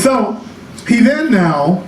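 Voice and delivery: usual speaking voice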